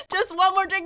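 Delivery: high, sing-song mock of a friend begging; mocking the friend's bar talk; mocking drunk friend. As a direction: tease it like a friend who wants another round. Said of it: "that is terrible mentality".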